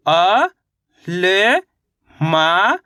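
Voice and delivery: "अ", "ल", "मा" one by one, each rising; अलग-अलग अक्षर. Shown as a demonstration. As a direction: sound out "अ", "ल", "मा" with long gaps. Teacher sounds out letters separately. ("अ", "ल", "मा" रुक-रुककर, चढ़ते सुर में)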